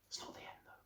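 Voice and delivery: hushed secret whisper. Acted. Reader confiding to the children, whispered. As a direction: lean in and whisper it.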